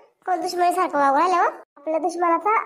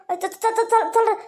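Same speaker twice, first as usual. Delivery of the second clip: panicked urging; 6 semitones higher than usual. Acted. Women panic at police station.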